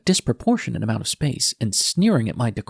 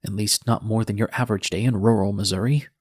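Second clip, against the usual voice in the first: usual speaking voice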